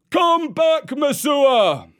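high, drawn-out angry call; crowd shout. A narrator's reading. Crowd orders Mesua to come back, shouting. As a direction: shout it high across a distance. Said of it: "shouted the crowd".

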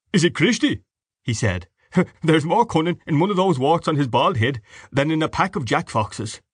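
a genial host just home from a walk; jovial father. Acted. Mr Dedalus laughing, mocking.

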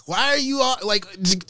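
ordinary delivery

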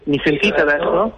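phone voice with echo; echo on the line. Real, not acted. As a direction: voice bouncing back on a bad phone line. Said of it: "però comunque c'è l'eco".

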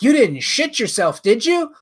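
high, loud teasing question. Retold or imitated. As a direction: tease dad on speakerphone. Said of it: "And I was like".

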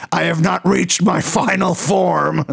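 slow, lightly put-on fast-food meltdown; a hint of a raging customer; mock tantrum. Retelling the viral McDonald's rant, slow mock fury. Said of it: "she was like".

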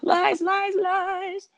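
high, mocking sing-song of lies, lies, lies; mock cry of lies. Friend mocks Tyrion's praise of Jorah with high sing-song. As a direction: scoff at the praise in a high put-on voice.

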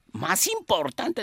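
high, drawn-out astonished question; incredulous. Acted. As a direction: echo it slowly in high-pitched disbelief. Boss incredulous at officer's answer.

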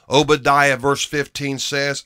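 ordinary talk

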